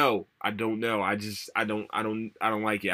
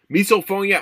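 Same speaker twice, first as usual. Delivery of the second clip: exclaimed; 11 semitones higher, 11 dB louder than usual. Real, not acted. Naming the condition emphatically.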